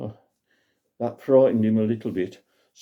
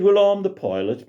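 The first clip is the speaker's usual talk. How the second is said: put-on a raised, proud old pilot's voice; 9 semitones higher than usual. Old pilot introducing himself to Grandad Sam, lightly raised voice. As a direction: announce yourself grandly as the pilot. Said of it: "He said".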